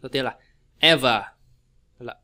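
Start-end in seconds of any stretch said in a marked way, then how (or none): 0.8-1.2 loud EV in "ever", then falling; teacher's recap, EV-er; first-syllable stress. Shown as a demonstration. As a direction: punch the start of "ever". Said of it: "nó sẽ là nhấn âm một".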